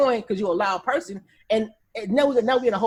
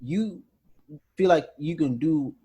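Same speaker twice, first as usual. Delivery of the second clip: slow, halting, controlled question; deliberate verifying; 2 semitones lower, 4 dB louder than usual. Acted. Verifying before spazzing off, slow and deliberate. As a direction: spread it out with heavy pauses. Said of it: "I verify, I make sure".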